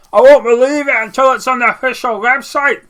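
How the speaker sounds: loud, high-pitched mock Cockney commenter; botched attempt at a Cockney accent, shouted; bungled Cockney impression. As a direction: put on a bad, over-the-top Cockney yell. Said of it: "what that accent or impression was".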